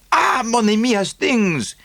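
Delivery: high, happy French greeting, with a lift; Poirot greeting his friend Hastings, high and delighted; delighted cry. A narrator's reading. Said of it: "he cried".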